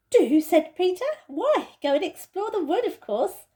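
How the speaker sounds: Peter's voice, excited. Acted. Peter agrees with Susan, excited. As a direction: burst out in delight.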